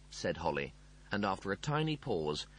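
usual speaking voice

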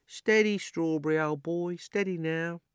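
gentle the frightened horse; soothing the horse. A narrator's reading. Cabby soothes his horse Strawberry, high and gentle. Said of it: "with the words".

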